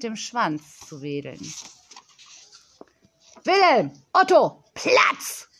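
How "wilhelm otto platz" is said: loud, high order to the dogs; commanding girl's call; sharp command. A narrator's reading. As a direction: call the dogs to heel like a little boss. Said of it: "befahl Yolanda".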